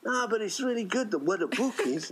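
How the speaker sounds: high, insistent fatherly plea; imitating the dad. The dad insisting his son watch The Stand, high insistent voice. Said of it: "And he'd be like".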